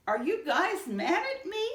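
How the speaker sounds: high, timid worried question; small mouse fearing his friends are angry. Acted. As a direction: ask it nervously, afraid of the answer. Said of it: "Stinky asked".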